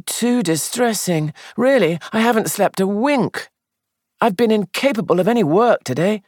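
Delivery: fretful, guilty young man, voiced high; distressed young man. Sandford distressed over the drowning.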